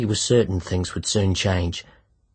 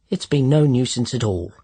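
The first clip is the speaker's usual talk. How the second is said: put-on raised, louder posh English accent; 5 semitones higher, 5 dB louder than usual. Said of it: "in a posh voice".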